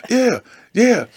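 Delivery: high, loud carefree cheer; excited cheering. Retold or imitated. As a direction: shout it like you don't care. Cheering, dismissing friends' warning.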